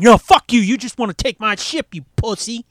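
loud, high retort; mock angry shout. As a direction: shout back at the admiral. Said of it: "you don't be like".

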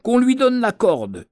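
a captain ordering the whip; mild narrated yell. A narrator's reading. Captain ordering the boy flogged, a mild mock yell. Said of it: "hurla-t-il".

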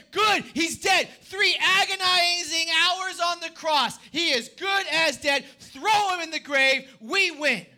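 loud, triumphant jeering; gloating shout. Acted. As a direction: crow over the victory. Leaders gloating over Jesus' death.

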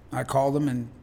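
ordinary talk

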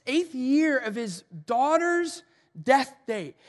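loud, driving pulpit delivery; big-hall preacher voice, pressing every line; preaching at full force. Acted. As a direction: preach it hard to the room. Preacher driving home grief points.

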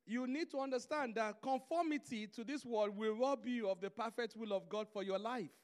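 ordinary delivery

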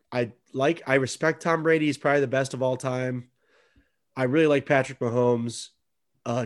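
ordinary delivery

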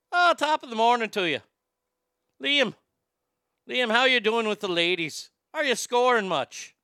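a jolly Irish dad asking about the ladies; hint of a cheery Irish father; Irish accent. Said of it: "he turned Irish".

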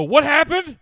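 very loud, high yell; exaggerated, high-pitched outcry; mock shocked shout. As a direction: holler it in fake alarm. Feigned shock to newcomer, retold.

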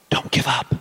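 hushed, voiceless whisper; whispered encouragement. Acted. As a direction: whisper it like an inner voice. Voice he hears encouraging him.

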